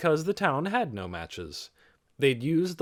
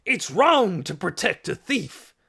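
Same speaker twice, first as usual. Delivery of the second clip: loud, higher angry roar; 8 semitones higher, 6 dB louder than usual. A narrator's reading. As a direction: roar at the villagers. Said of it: "he roared".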